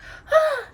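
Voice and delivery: very high, loud astonished gasp; sharp gasp. Acted. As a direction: gasp in wonder. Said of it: "Cinderella gasped".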